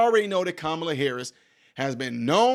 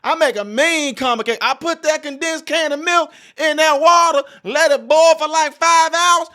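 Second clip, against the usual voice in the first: mock sassy auntie; 10 semitones higher, 8 dB louder than usual. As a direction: belt it like a loud church auntie bragging about cake.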